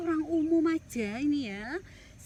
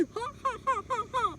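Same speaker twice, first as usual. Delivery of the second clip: mocking giant laugh; 7 semitones higher than usual.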